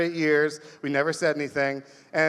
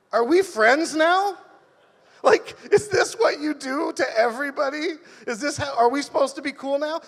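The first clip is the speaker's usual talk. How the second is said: confused disbelief; 8 semitones higher than usual. Retold or imitated. Asks drunk neighbour after groping.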